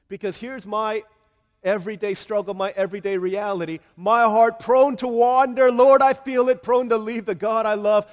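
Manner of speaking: loud, climbing preacher's cry; full-force preaching. Acted. Pastor pleading with the congregation, loud preaching. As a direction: preach it out over the music.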